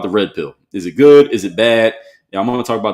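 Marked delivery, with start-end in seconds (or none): 0.7-1.9 loud teaser question. Real, not acted. Teasing next topic.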